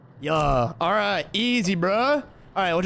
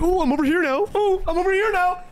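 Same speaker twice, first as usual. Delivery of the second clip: high-pitched mocking voice for the enemy; exasperated, sing-out mockery, pitched way up; mocking imitation; 11 semitones higher than usual. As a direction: mimic him in a taunting high voice. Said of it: "He's like".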